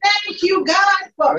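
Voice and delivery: loud, high, full-force praise shouts; fervent praise. Real, not acted. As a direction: cry out thanks to God at full power.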